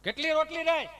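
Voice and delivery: acted impatient shout; calling to wife. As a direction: call across the house. Husband calls out to wife, acted.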